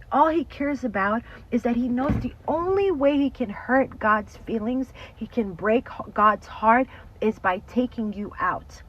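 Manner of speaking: loud, rising preacher's warning; fiery sermon voice; preaching at full force. Real, not acted. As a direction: preach it out to the congregation. Preaching about the enemy.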